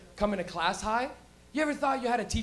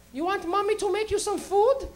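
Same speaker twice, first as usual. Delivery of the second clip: high, coddling mommy voice; imitated mom; 10 semitones higher than usual. Mom to stoned son offering food, high-voiced. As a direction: offer it in a pitched-up mother voice. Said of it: "So she'd be like".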